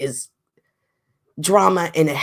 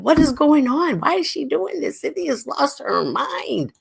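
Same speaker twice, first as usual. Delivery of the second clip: higher, puzzled imagined viewer; higher mock bewilderment, slowing on "lost her mind"; mock baffled viewer; 10 semitones higher than usual. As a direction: play the confused fan wondering what's up. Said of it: "and you're thinking".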